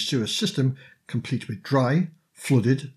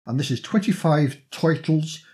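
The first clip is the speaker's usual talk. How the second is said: put-on New York accent, toitles; playful New York accent on turtles; 4 semitones higher than usual. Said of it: "why do I have to say it in a New York accent".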